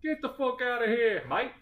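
high mock Jersey-Australian mix; New Jersey-Australian accent. Welcome to New Jersey, Australia, mashed-up mock accent. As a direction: put on Jersey tough talk, Australian twist. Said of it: "Welcome to New Jersey, Australia".

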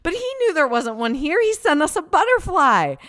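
high, loud, awestruck exclamation; bursting with wonder; amazed. Retold or imitated. Mom telling her girls about the butterfly, amazed and loud. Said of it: "I said".

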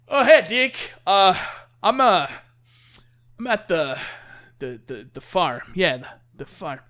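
put-on slurred-drunk act, halting and groping for words; drunk Boozer. Drunk biker tells his friend where he is, halting drunk voice. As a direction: play a drunk losing his train of thought. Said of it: "Boozer got drunk and wandered".

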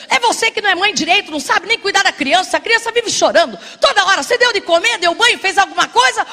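loud, furious rant at his wife, nearly shouting; husband shouting at his wife about the crying child, furious; angry husband yelling. Acted. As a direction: blow up at her like an exhausted, offended husband.

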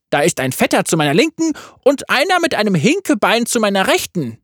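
high, cheeky yordle voice; playful little gunner; Tristana voice. A narrator's reading.

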